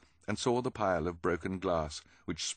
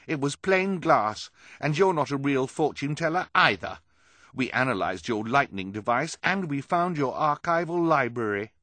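angrily; 8 semitones higher, 7 dB louder than usual. Acted. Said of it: "Violet translated angrily".

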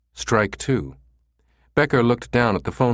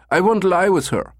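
put-on "won't lie with her" pitched very high, German-accented; 11 semitones higher, 4 dB louder than usual. Caller asks for the redhead, high German accent. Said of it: "in his German accent".

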